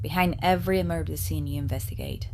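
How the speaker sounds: ordinary talk, nothing marked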